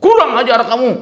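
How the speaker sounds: loud, high retold outburst; angry scolding. Retelling a driver cursing his rival, angry. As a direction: imitate an irate driver's sharp rebuke. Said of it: "Marah dia".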